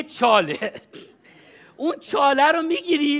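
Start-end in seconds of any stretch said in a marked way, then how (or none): none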